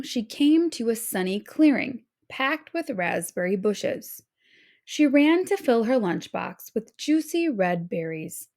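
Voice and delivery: usual speaking voice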